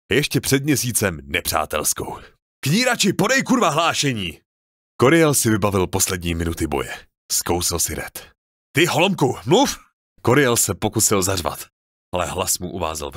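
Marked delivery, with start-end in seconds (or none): 2.6-4.4 desperate shout. Acted. Coriel yells at his dead comrade for a report, frantic shout. 8.8-9.8 high, loud furious order; angry shout. Acted. Officer shouts at the speechless Coriel, angry yell.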